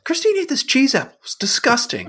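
high-pitched mock tattletale; tattling child. Child tells mom about sister's snack, high tattling. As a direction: imitate a kid telling on a sibling.

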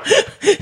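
sudden high burst of laughter; a real high laugh. Real, not acted. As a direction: crack up at the punchline. Laughing at the joke.

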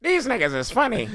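high amused alien voice; laughing alien on its spaceship. Pretend.

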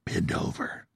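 hushed creepy aside; Warren Buffett. Retold or imitated. Host mocking a kindly billionaire's hidden menace, creepy whisper. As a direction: whisper it as a mock villain. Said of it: "I'm Warren Buffett".

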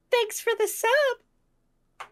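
put-on high, slow squeaky anime girl; VTuber girl voice. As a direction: drawl it like a cutesy streamer. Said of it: "only say".

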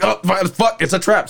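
high panicked cry of alarm; panicked duck voice. Retold or imitated. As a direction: squeal it like a trapped duck. Female duck voiced in story.

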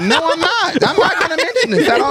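high laughing fake denial; mock denial. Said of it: "I was like".

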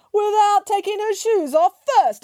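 high-pitched scandalised declaration; emphatic declaration. A narrator's reading. Grandmother revealing another crime to children, emphatic declaration. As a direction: declare it high, as the crowning outrage. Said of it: "declared Grandmother".

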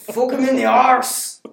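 loud, high, drawn-out Scottish holler; over-the-top shouted Scottish accent; hammed-up Scottish accent. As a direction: bellow it slowly in broad Scottish. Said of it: "I can do Scottish".

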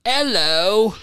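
a cartoon British hello; mock British accent. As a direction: toss out a hammy British hello. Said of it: "speak in a British accent".

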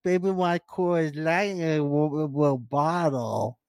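put-on light cutesy baby voice; mock baby talk, halting. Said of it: "She's doing your baby".

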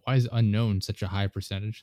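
normal talking voice

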